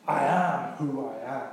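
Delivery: low, grave words of God; solemn god voice. Retold or imitated. Preacher voicing God to the congregation, low and grave. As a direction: intone it like God naming himself. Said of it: "It just means".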